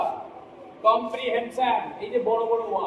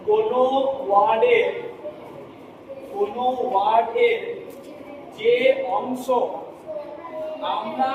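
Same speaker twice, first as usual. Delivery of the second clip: word-by-word reading. Shown as a demonstration. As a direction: read out in separate chunks with long pauses. Reads the written definition aloud. (থেমে থেমে পড়ে শোনানো)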